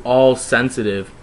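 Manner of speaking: plain reading voice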